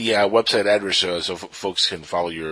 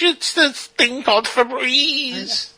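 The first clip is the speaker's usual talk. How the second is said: slow, high, strange alien voice; much slower than usual. Pretend. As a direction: drag it out like an alien. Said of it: "That's my alien voice".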